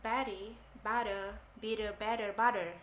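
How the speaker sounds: T in "butter" and "better" softened into a quick D, American style; American flapped T. Shown as a demonstration. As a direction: say "bitter" with the T flapped to a soft D. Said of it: "Pronounce-nya soft D banget gitu". (T Amerika dibaca seperti D lembut)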